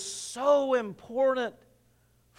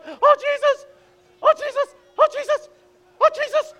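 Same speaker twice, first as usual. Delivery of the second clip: much higher, louder, panicked pleading at the edge; frightened pleading; 6 dB louder than usual. Retold or imitated. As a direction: beg in rising fear while dangling. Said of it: "And she's like".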